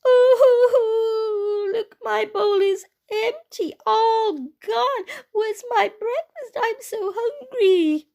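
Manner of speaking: high, loud, wailing little bear; crying Baby Bear. Acted. Baby Bear finds his bowl empty, crying. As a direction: wail it like a hungry small child in tears. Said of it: "he started to cry".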